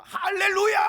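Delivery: high, loud happy shout; mock joyful hallelujah. Congregant whose wish came true, loud jubilant shout. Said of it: "막 이럽니다".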